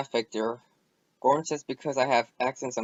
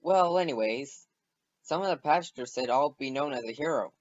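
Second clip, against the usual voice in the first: plain reading voice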